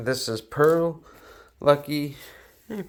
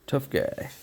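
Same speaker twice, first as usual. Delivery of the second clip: mock tough guy.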